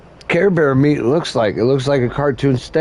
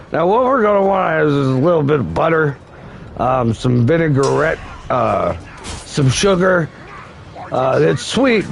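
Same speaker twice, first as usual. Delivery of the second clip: usual speaking voice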